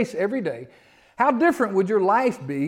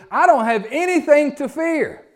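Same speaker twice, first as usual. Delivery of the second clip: exclaiming David; 8 semitones higher, 5 dB louder, slower than usual. Acted.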